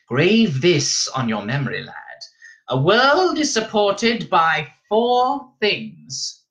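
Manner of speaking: put-on high, drawn-out old woman's pronouncement; stern, high-pitched elder; old Reverend Mother, solemn. A narrator's reading. Old woman instructs the boy.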